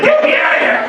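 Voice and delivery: high laughing cry for help, not loud; mock plea. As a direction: squeal it out through a laugh. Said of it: "was like".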